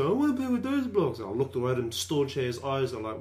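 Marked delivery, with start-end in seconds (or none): 0.0-1.2 put-on high, demanding kid voice; mock bratty kindergartner; whiny kid. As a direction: whine for the blocks like a little boy. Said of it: "and then this guy".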